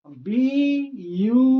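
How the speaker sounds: ब्यूटीफुल drawn out slowly, syllable by syllable. Shown as a demonstration. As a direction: stretch ब्यूटीफुल slowly so pupils hear each syllable. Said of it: "ब्यूटीफुल में अगर". (ब्यूटीफुल को धीरे-धीरे खींचकर बोलना)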